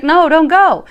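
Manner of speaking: imitated tearful plea. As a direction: plead loudly as the crying woman. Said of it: "She's crying".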